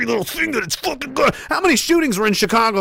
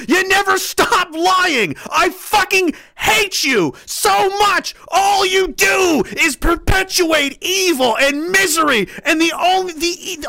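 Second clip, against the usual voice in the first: slow, high, shouted hatred; furious shout; 8 semitones higher, slower than usual. Real, not acted. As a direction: scream each word at them slowly. Furious rant at the liars.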